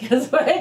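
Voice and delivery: Keswick with the W sounded; everyday pronunciation. Shown as a demonstration. Host quoting everyday people, amused, Keswick with a W. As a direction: say Keswick as "Kez-wick", with a laugh. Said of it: "everyday normal people call it".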